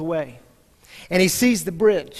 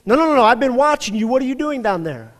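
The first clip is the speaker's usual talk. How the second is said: higher, louder, fast pressing; insistent officer; 7 semitones higher, 8 dB louder, faster than usual. Retold or imitated. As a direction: rattle it off, cutting him short.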